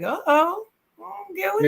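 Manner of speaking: high-pitched playful uh-oh; mock alarm. Host recalling reaction to a sing-off video, mock alarm. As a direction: act out a teasing, worried reaction.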